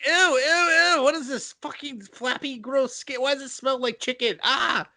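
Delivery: high squealing disgust. Retold or imitated. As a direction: shriek it out grossed out. Disgusted pyro girl voice.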